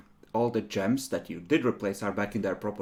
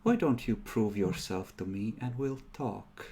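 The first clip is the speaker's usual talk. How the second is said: a hint of a sly temptress; sinister voice; 2 semitones lower, 9 dB quieter than usual. GM voices Monster Mom.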